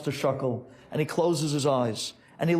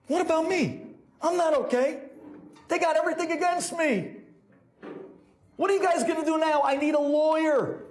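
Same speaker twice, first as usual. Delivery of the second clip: high, frantic complaint; acted desperate client; panicked outburst; 13 semitones higher than usual. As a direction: wail it in a sustained high pitch. Client panics about his own fate.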